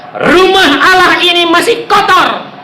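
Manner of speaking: high, forceful declaration; divine rebuke. Retold or imitated. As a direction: thunder it as God's rebuke. God's rebuke voiced in testimony.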